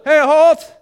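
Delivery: loud, high shout to stop the troops; shouted halt. Acted. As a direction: bark the halt across the field. Soldier stops the army suddenly.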